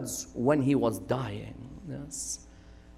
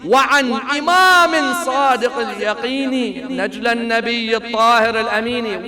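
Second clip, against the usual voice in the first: Arabic verse recitation; 4 semitones higher, 5 dB louder than usual. Acted. Preacher quoting Abul Fadl's vow to his listeners, recited verse. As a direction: intone the Arabic lines with measured weight.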